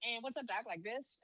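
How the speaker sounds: high mock Bugs voice on what's up, Doc; Bugs Bunny impression. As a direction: toss off the catchphrase in a high cartoon voice. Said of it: "Does he talk like this?".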